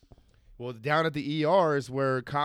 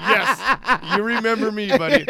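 laughing, loud taunt back; mocking laugh; 6 semitones higher, 9 dB louder than usual. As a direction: rub it in while laughing. Narrator mocks the arrested guy.

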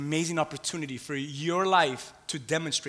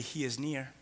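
soft affirmation; 4 semitones lower, 10 dB quieter than usual. Shown as a demonstration. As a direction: quietly, close and low, "He is near". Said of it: "Just look at them and say".

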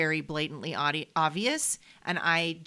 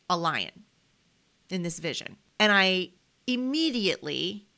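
normal talking voice

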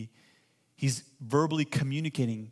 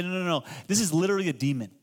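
quick emphatic quote; 4 semitones higher, 4 dB louder, faster than usual. Retold or imitated. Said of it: "other pastors say".

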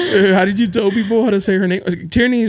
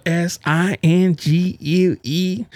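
letter by letter, slow; spelled out slowly; much slower than usual. Shown as a demonstration. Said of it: "Single, right?".

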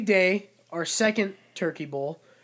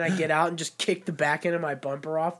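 normal talking voice

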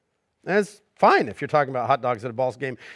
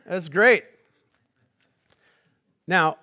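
usual speaking voice

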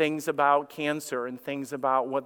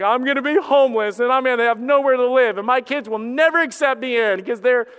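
mock panic; 7 semitones higher, 7 dB louder than usual. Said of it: "your minds go crazy".

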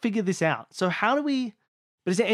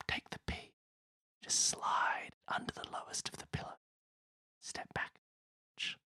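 whispering; 16 dB quieter than usual. Pretend. Sneaking pea under sleeping cat.

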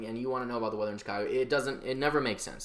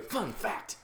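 segment announcer; 5 semitones higher, 5 dB quieter than usual. Shown as a demonstration. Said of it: "that's how I'm gonna do it".

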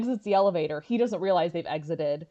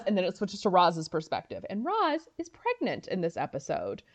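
ordinary delivery

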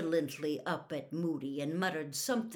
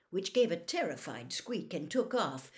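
plain reading voice

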